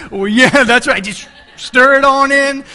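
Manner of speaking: loud, high, slow and breezy; mock shrug. Preacher teasing the men's answer, mock nonchalant and loud. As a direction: sell the cheat like it's nothing.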